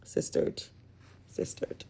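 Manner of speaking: "Sistered" whispered breathlessly twice. Real, not acted. Recapper whispering an aside about Jasmine being cheated on. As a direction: drop to a secret whisper.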